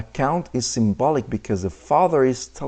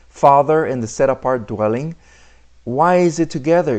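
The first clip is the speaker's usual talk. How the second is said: ordinary delivery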